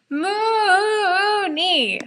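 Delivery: long high howl of a name, stretched out. Acted. Drunk Sirius howling for Mooney across the party. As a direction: howl it like a drunk party host calling for a friend. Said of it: "Sirius howls".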